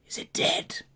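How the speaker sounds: unvoiced hiss of a spider guard; hissing whisper. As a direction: hiss the question over the body. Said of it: "you can hear it hissing".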